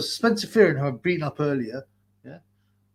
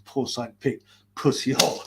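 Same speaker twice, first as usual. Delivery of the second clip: ordinary delivery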